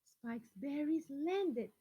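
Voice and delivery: put-on lively picture-book reading to children; storyteller voice for kids; story reading. A narrator's reading. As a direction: read it out to the class with expression. Reads a picture book aloud.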